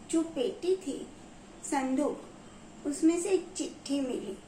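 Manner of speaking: ordinary delivery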